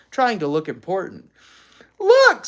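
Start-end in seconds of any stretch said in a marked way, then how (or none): 2.0-2.5 put-on very high, loud girlish squeal; girl's squeal. A narrator's reading. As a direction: squeal it with delight. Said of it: "squealed a girl".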